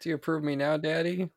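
put-on a young monster asking his father for approval; kid seeking approval. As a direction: ask dad for praise like a small child. Voices the son seeking approval.

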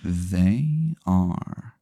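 slow and hushed, fading to a whisper; hushed reveal. Acted. Game master answering about the wings, hushed dramatic reveal. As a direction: drop to near silence on the last word.

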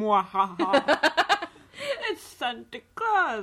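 slow, loud mock villain cackle into the name; drawn-out evil laugh, then announce yourself as Santa. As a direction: ham up a sinister Santa. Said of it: "add an evil laugh afterwards".

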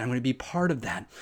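normal talking voice

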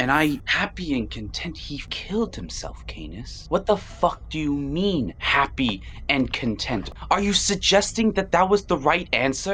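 indignant, incredulous outburst, rising in pitch; appalled demand for an explanation; outraged. Acted. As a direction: challenge him in disbelief, voice climbing. Acted outrage at Canis's words.

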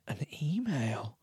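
soft unvoiced whisper of the word. Real, not acted. Host muttering to self while reading, whispered. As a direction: breathe it out under the breath.

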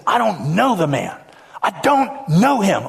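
mild acted outburst, rising on the repeat; losing it, cursing. Peter, confronted a third time, denies knowing Jesus, losing it. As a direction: deny it with growing exasperation. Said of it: "he loses it. He curses".

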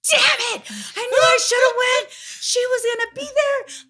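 frustrated wail. Retold or imitated. Seeing the meetup photo she missed, crying high wail. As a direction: wail it like you missed out, near tears. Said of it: "I was like".

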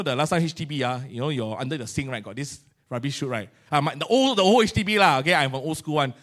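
usual speaking voice

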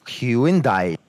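Hyundai as HYU-in-DYE, drawn out in three parts. Shown as a demonstration. Caller plays his version to the helper, Hyundai stretched to hyu-in-dye. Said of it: "we've been saying".

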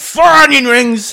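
high, loud, slow yell; scream to heavens. Retold or imitated. Cohost echoing Jacques's skyward yell, loud. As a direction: bellow the order skyward. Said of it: "his accent slips away entirely".

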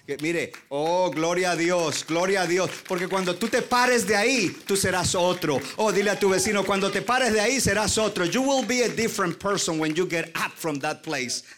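loud, rising preacher's cry; preaching at full force. Acted. Pastor urging the congregation, loud fervent preaching.

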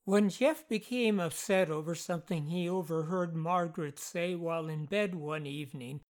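usual speaking voice

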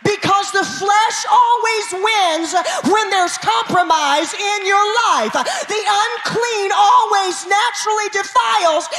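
loud, high preacher's cry; preaching at full force. Acted. Pastor preaching hard to the congregation, loud and high.

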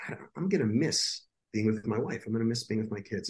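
hushed, choked-up thoughts; quietly grieving, close to tears; soft tearful voice, low and quiet. Retold or imitated. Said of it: "I'm just sitting here crying".

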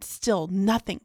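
ordinary talk, nothing marked

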